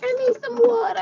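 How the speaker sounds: put-on high little girl voice; child voice. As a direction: say it like a small daughter. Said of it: "She was like".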